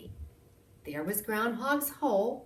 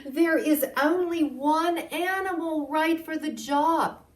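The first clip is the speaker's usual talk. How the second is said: a lightly voiced mayor, higher and louder; mayor's declaration; 8 semitones higher, 6 dB louder than usual. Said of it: "the mayor cried".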